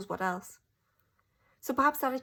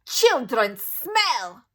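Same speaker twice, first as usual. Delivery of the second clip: very slow, loud witch scream; Grand High Witch screaming about children; 4 semitones higher, 12 dB louder, much slower than usual. A narrator's reading. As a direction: stretch it out in disgust. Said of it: "she screamed".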